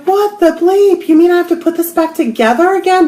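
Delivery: high, loud exasperated disbelief; shocked outburst. Retold or imitated. Finding her snapped pendulum: high shocked exclamation. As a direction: squeal it out in dismay. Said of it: "and I was like".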